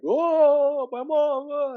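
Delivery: high, loud, drawn-out mock sobbing; mock tearful wail. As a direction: wail it like a hammed-up movie reunion. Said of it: "and cry and all that stuff".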